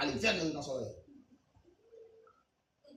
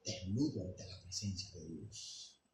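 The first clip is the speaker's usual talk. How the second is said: low, much softer insinuating voice; whispered in the ear; 8 semitones lower, 11 dB quieter than usual. Retold or imitated. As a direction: murmur it like a tempter at the ear. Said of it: "la religión me habló al oído".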